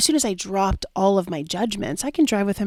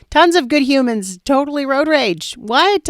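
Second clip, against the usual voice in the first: louder, higher-pitched disbelief; incredulous exclamation; 6 semitones higher, 8 dB louder than usual. Real, not acted. Coach debunking her belief about good humans, incredulous. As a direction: exclaim it as obviously absurd.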